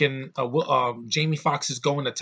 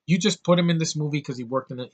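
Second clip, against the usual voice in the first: ordinary talk, nothing marked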